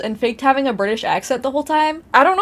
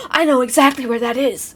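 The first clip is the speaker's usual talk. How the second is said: mock recognition; 2 semitones higher than usual. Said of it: "and they're like".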